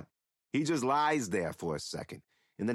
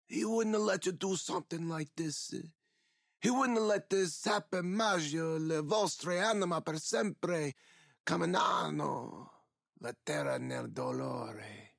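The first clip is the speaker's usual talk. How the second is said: put-on old man's Italian curse; 5 semitones higher than usual. A narrator's reading. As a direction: lay a dark curse in Italian.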